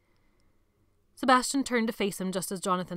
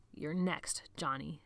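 soft, low threat; quiet menace; 4 semitones lower, 7 dB quieter, slower than usual. A narrator's reading. Sebastian threatening Jonathan under his boot. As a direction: let the threat drop low.

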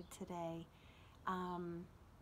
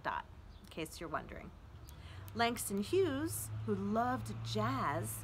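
expressive reading; 4 dB louder than usual. Acted. Reads author's note aloud.

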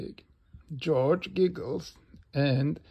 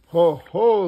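loud, raised ho-ho; big spoken belly laugh; 4 semitones higher, 11 dB louder than usual. A narrator's reading. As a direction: act a hearty storybook laugh. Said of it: "laughs Mister Bull".

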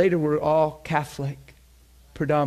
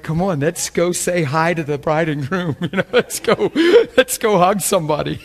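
louder, laughing rally to the crowd; laughing call; 3 semitones higher, 6 dB louder than usual. Retold or imitated. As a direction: gather everyone up with a laugh. Calling congregation to greet couple.

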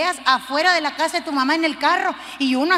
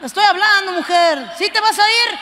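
más agudo y fuerte, gritando a la familia; grito de marido; 5 semitones higher, 5 dB louder than usual. Acted. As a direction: vocifera sin levantarse. Marido grita desde el sillón. (marido sentado que grita a su mujer)

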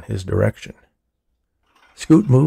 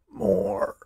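high, much softer, weak plea; feebly; 12 semitones higher, 5 dB quieter than usual. A narrator's reading. Dying old man asking the orderly feebly for more. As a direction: let the word barely come out. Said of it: "this time feebly".

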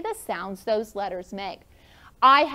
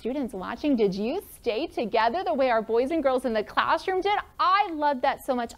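ordinary delivery

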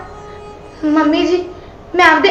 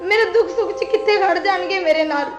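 softer, tearful complaint; 10 dB quieter than usual. Acted. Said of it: "ਰੋ ਨਾ ਤੂੰ।".

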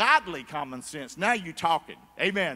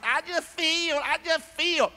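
high, whiny I just feel; mock a fool who trusts feelings; high mocking whine; 8 semitones higher, 4 dB louder, slower than usual. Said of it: "You a fool".

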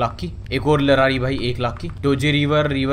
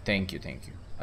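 whispered thanks; 11 semitones lower, 14 dB quieter, much slower than usual. Acted.

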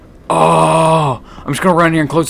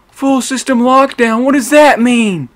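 alarmed; 8 semitones higher than usual. Real, not acted. Alarmed by lockdown announcement.

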